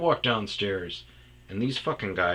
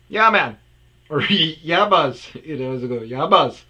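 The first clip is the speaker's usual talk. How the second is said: higher, much louder bro reply; 7 semitones higher, 11 dB louder than usual. Retold or imitated. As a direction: holler back cheerfully. Coworker answering loudly.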